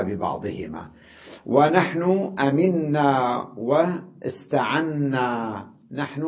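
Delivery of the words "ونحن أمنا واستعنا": أمنا and واستعنا said slowly with long doubled ن; slow doubled nun. Shown as a demonstration. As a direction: stretch each doubled ن. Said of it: "ألفظها نونين". (نطق النون المشددة ببطء)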